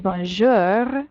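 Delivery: slow "bonjour" with a growled final R; growly bear R. Shown as a demonstration. Teacher models the ending for students, growly French R. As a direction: hit the R ending "bonjour" in the throat. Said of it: "you hit your growly bear R's".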